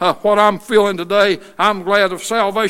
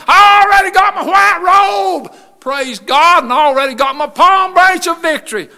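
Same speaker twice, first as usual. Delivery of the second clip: high, ringing preacher's testimony; exultant shout; 6 semitones higher, 3 dB louder than usual. Real, not acted. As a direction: shout it out in triumph. Testifying at full force to congregation.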